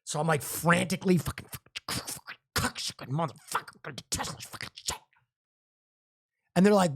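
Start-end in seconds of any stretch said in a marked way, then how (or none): 1.2-5.0 unvoiced string of curses; cursing under breath. Fumbling with the Tesla app, whispering curses. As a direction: whisper the swearing in frantic panic.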